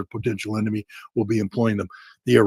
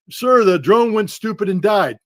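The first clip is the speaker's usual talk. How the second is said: mock soldier report; 7 semitones higher, 4 dB louder than usual. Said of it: "soldier reports lost drone".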